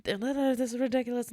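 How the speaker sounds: imitating an upset customer. Upset hotel guest complaining to the manager, mildly indignant.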